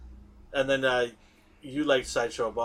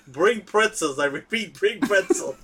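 raised, louder cartoon police chief on the radio; Chief Wiggum; 6 semitones higher, 5 dB louder than usual. Fan reenacting a favorite scene for laughs, Chief Wiggum impression. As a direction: call it in like a comic police chief. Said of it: "you see Wiggum going".

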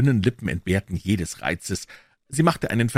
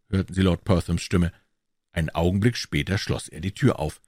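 usual speaking voice

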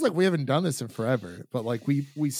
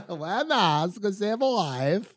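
high, laughing imitation of a foreign accent; mock Borat-style accent; 6 semitones higher, 3 dB louder, much slower than usual. Host parroting co-host's mask slogan, laughing mock accent. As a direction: echo it in a hammed-up accent, breaking into laughter.

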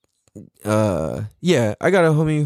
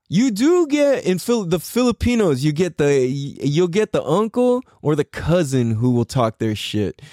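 ordinary delivery

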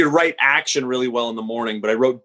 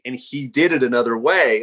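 usual speaking voice

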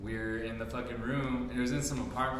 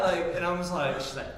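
ordinary talk, nothing marked

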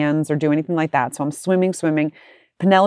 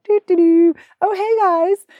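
high, louder sing-song doo doo; mocking carefree dad; 14 semitones higher, 4 dB louder than usual. Son mocks dad idly humming on the boat, sing-song. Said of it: "He's like".

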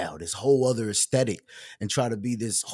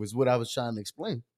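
ordinary talk, nothing marked

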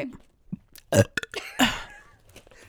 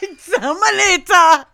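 word bursts out on a laugh, higher and louder; 6 semitones higher, 7 dB louder than usual. Real, not acted. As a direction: crack up mid-word. Reading the word, cracking up.

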